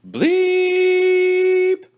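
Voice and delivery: a long steady high beep; high robotic bleep; high electronic bleep. Acted. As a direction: imitate a buzzer tone. Alarm sound imitated.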